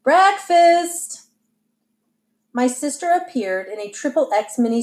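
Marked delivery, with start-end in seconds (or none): 0.0-1.2 high, drawn-out call to the table; mother's lilting, raised-voice summons; singsong call. A narrator's reading. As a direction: sing out that the meal is ready. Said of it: "my mother sang out".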